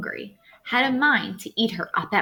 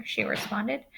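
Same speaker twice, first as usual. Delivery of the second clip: plain reading voice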